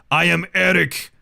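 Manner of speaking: loud, slow proclamation of a villain's name; Warhammer chaos Viking. Acted. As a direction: announce yourself like a big chaos warrior. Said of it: "the name of a special character from Warhammer".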